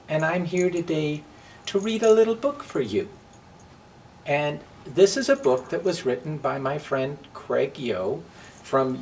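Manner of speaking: put-on welcoming kids to story hour, gently; reading to children. Drag queen greeting children at story hour, lightly sing-song.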